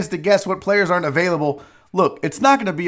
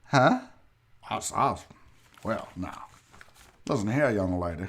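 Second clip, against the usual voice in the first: low, soft Southern gentleman voice; Southern accent; 9 semitones lower, 8 dB quieter than usual. Pretend. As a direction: purr it like a Southern aristocrat. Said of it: "Rachel likes my Southern accent".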